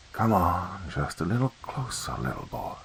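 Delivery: soft whispered hiss, fading away; choked snake voice. Acted. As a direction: lure the boy closer like a choking snake. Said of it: "sounded like a snake that was being choked".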